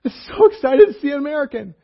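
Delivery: high, gushing excitement; overjoyed. Retold or imitated. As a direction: burst with joy at meeting fellow Americans. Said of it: "Joy, happiness, like, unity".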